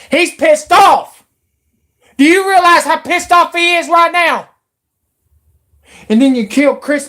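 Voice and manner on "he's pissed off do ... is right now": real anger boiling over into a shout; angry shout. Real, not acted. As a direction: yell it like you're fed up. Ranting about angry singer.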